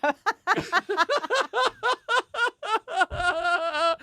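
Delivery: long helpless laugh. Real, not acted. Big laugh at the joke.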